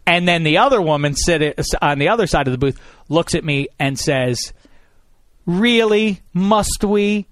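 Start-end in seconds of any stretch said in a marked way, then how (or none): 5.5-7.3 disdainful. Retold or imitated. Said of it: "in the same disgusting tone".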